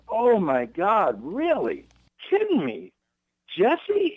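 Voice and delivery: stunned surprise. Real, not acted. Hearing of another brother, climbing amazed surprise. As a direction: pitch climbing in amazed disbelief.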